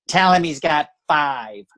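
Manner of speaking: mock whiny matriarch, drawling; Mitzi Shore. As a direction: stretch the words in a whiny impression. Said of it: "she doesn't have a church voice".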